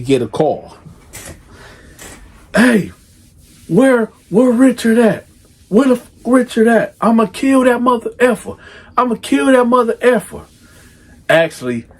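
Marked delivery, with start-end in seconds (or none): 2.5-10.4 raised, much higher voice, cussing softened; furious dad censoring himself; mock furious father. Father calling the mother about their pregnant daughter, mock furious raised voice. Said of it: "I'ma say it the way that he said".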